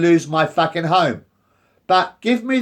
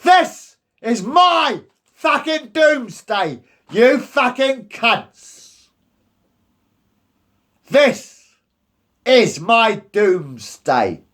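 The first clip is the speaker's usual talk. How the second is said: slow bitter; 2 semitones higher, slower than usual. Real, not acted.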